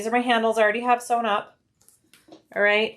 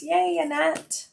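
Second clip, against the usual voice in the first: high, slow, delighted yay; excited cheer; 8 semitones higher, slower than usual. Real, not acted. Sewing host cheers a chat viewer, excited.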